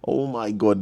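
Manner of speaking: ordinary talk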